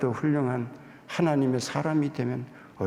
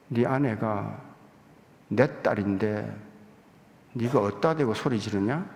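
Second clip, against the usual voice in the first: a hushed stern voice of God asking; God's rebuke; 6 semitones lower than usual. Retold or imitated. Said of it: "하나님께서 그런 생각을 줘요".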